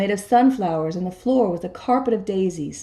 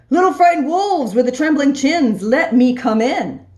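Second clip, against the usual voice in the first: high, louder mocking pig coaxing; sly pig; 5 semitones higher, 5 dB louder than usual. Big bad pig taunting the frightened wolves over the phone. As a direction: taunt the wolves through the door.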